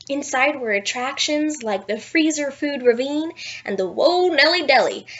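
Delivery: usual speaking voice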